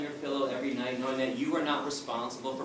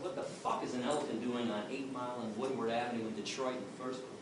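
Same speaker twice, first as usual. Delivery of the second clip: stunned curse; 4 semitones lower, 7 dB quieter than usual. Retold or imitated. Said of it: "I said".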